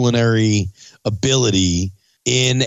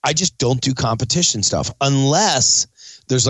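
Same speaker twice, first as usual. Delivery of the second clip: usual speaking voice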